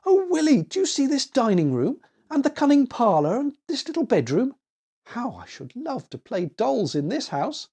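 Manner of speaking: put-on high eager child voice; excited little girl. A narrator's reading. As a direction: gush over each room like a small girl. Annie excited about doll house.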